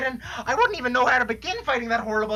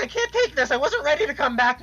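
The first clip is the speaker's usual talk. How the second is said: sobbing; 2 semitones higher, 3 dB louder than usual. Acted. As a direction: cry it out, at the end of her rope. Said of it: "this fucking crying's making me lightheaded".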